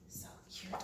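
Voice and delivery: whispered aside. Real, not acted. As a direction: breathe it out softly. Adoring aside.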